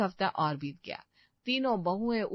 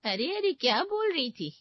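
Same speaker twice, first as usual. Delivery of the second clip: eager gossip; 8 semitones higher, 4 dB louder than usual. Acted.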